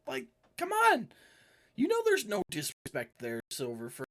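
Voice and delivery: high-pitched whiny defence; squeaky NPC voice. Acted. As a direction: play a cornered little guy whining his excuses. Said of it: "He's like".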